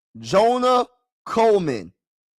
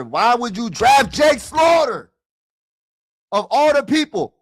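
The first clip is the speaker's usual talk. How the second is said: frustrated shout; 5 semitones higher, 4 dB louder than usual. Real, not acted. Streamer venting at his team's draft pick, exasperated shout. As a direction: shout it out at the screen in disbelief.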